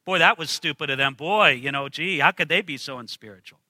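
a lightly voiced smug Bible reader; smug attitude. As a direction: sneer at them like you know better. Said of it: "with this kind of attitude that says".

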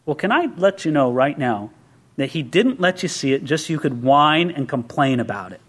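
usual speaking voice

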